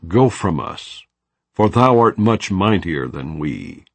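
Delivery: usual speaking voice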